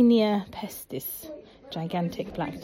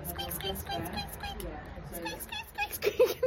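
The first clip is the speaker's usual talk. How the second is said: much higher and softer mouse squeaks; tiny squeaks like a toy rat; puppet rat squeaks; 8 dB quieter than usual. Pretend. Mum making the rat puppet squeak for the child, much higher and softer.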